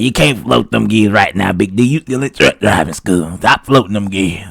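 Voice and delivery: put-on lightly raspy older man voice; a hint of a raspy old instructor. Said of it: "This how he talk".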